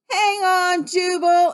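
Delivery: high, loud call of reassurance; anxious call. Acted. Rescuer calling to the stranded frog, anxiously. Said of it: "an anxious voice called".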